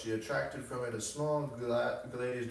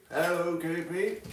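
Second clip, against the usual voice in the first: calling out; 7 semitones higher, 6 dB louder than usual. Real, not acted. Calling out to someone off-book.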